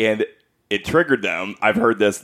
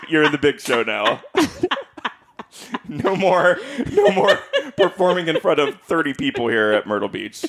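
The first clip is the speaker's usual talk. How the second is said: higher, laughing through a mock farewell; laughing mock send-off; 5 semitones higher than usual. Sister mocking the performer's big promotion, laughing. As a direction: giggle out a grand send-off.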